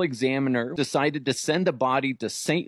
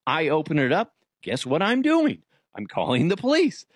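ordinary talk

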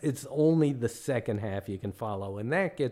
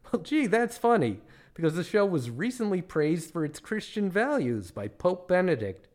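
high-pitched, eager-to-please rebuttal; naive tourist; 5 semitones higher than usual. Acted. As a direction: counter politely in a raised, innocent voice. Himself politely countering the guide.

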